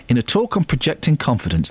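ordinary delivery

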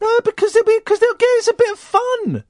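high-pitched indignant outburst; indignant protest. Real, not acted. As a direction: protest excitedly, voice shooting right up. Protests against ban on voices.

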